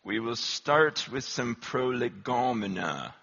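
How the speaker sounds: slow, low English professor voice; low-pitched English accent, unhurried. Retold or imitated. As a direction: open a lecture in a measured English accent. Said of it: "he had an English accent".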